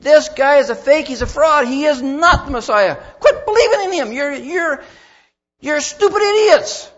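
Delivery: loud, high outburst of scornful accusation; very emphatic. Acted. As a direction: shout it like outraged leaders denouncing a fraud. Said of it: "They had made it very emphatic".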